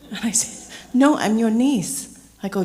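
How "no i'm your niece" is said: loud, higher, drawn-out insistence; retold exasperated correction, slow and loud; emphatic correction. Niece setting her teasing uncle straight, emphatic.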